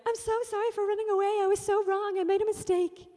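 very high, loud mock wail; hammed-up apology. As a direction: overact the repentant runaway brother. Hammed-up Hollywood reconciliation, younger brother.

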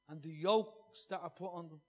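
ordinary talk

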